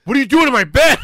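high, very loud indignant yell; mock-outraged shouting; retold outrage. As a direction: yell it like he's the intruder. Retold drunk intruder's outraged question.

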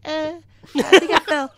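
high, wobbly groan after a fall. Retold or imitated. Retelling a singer's tumble to friends, high mock-dazed voice. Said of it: "Michael, you okay?".

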